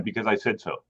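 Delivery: imitating mom. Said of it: "what did Mom used to say".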